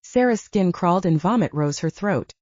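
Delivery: usual speaking voice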